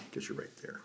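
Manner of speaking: ordinary delivery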